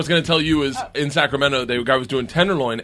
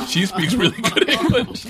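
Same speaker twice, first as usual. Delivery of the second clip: imitating it higher and slower, laughing; retold overheard whisper; 7 semitones higher than usual. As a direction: draw out the overheard aside through laughter.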